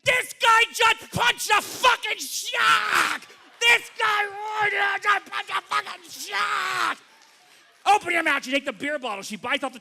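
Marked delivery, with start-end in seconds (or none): 0.0-7.0 much higher, louder, slow triumphant shout; jubilant shouting. Retold or imitated. Mez celebrating the shark punch, shouting high and slow. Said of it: "and she says". 7.9-8.4 bossy call. Retold or imitated. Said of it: "she says".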